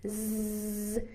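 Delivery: long voiced buzzing z; isolated z buzz, voice on; buzzing z sound. Shown as a demonstration. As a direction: hold a steady buzzing z on its own. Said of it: "remember when we made the Z".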